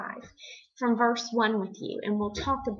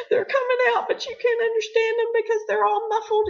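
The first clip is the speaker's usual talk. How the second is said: imitating crying speech; 13 semitones higher, 7 dB louder than usual. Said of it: "so upset that you're crying".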